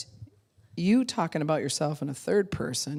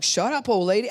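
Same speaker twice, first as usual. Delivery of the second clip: put-on louder, higher snappy teen retort; mock teen snapping; 6 semitones higher, 7 dB louder than usual. Teenager snapping at his mother, louder mock retort. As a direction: toss it back like a sullen teenager.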